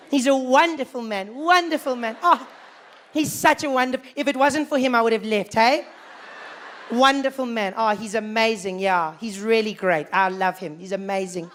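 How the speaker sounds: relieved gushing. Retold or imitated. Worried people praise Mandela.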